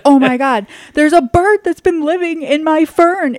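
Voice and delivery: high, loud startled cry of alarm; retold panic. Wife retelling finding a bird in her fern, high panicked cry. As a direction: shriek it like you just found a bird in the fern.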